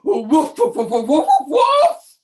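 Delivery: showy woofing that climbs at the end; glorious dog woofs. Acted. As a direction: bark out a proud, musical volley. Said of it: "he gave the most glorious".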